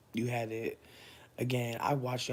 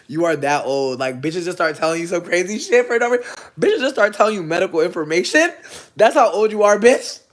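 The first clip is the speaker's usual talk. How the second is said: loud rant climbing higher and louder; insistent yelling; 5 semitones higher, 14 dB louder than usual. Real, not acted. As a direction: build to a high shout at the crowd. Yells at viewers about getting old.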